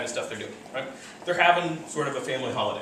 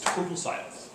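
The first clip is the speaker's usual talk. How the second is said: whispered; 6 dB quieter than usual. Shown as a demonstration. Describing chimps going silent.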